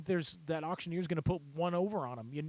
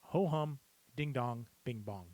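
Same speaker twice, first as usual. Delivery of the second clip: tone-finding phrase. Shown as a demonstration. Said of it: "Say that".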